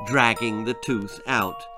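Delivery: plain reading voice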